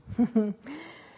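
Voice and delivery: a high, loud burst of laughter; bright laugh. Real, not acted. Coach laughing at her own remark, a high, loud laugh.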